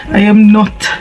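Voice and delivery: normal talking voice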